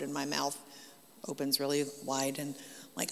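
ordinary delivery

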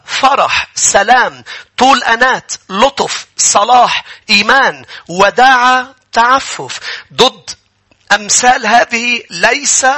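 high, measured list with pauses; scripture proclamation. Acted. Preacher reading a Bible verse to the congregation, proclaiming.

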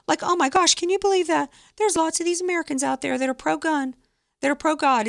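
high, sneering mock disbelief; host mocking Twitter staff who laugh at conservatives, high sneering voice. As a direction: mimic the scoffers with a high sneer.

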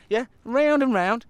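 usual speaking voice

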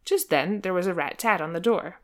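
ordinary delivery

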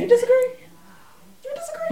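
high, shocked question; can't believe it; incredulous. Real, not acted. Incredulous pair.

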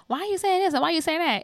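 high, whiny-pitched imitation of a hurt mom; mock hurt. Daughter mimicking mama hurt at being made fun of, mock hurt. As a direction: play up the wounded questioning, pitched high. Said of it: "she'd be real hurt".

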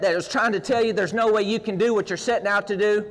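ordinary delivery

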